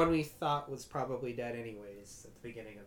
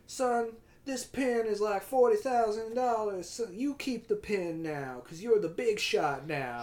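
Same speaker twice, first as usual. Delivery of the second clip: slow, high southern drawl; hammed-up southern boss, stretching every word; Al Pacino, southern accent; 9 semitones higher, 4 dB louder, slower than usual. Boss hands his pin to employee, mock southern drawl. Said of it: "He's kinda doing a southern, like, molasses".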